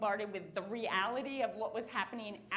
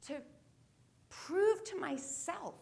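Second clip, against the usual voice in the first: slow emphasis; 5 semitones higher, slower than usual. Real, not acted. Slow emphatic motivational line.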